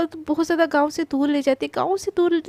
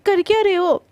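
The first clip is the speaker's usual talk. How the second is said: sharp challenge; 4 semitones higher, 6 dB louder than usual. Acted. Krishna catches Prachi following.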